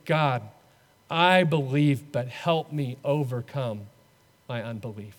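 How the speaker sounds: a small honest plea, let it die away; humble prayer. Acted. Believers hold out their hands to God, soft and humble.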